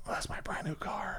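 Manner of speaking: whispered aside, barely any voice; hushed worry. Retold or imitated. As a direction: drop to a worried whisper. Retelling worry over leaving new car.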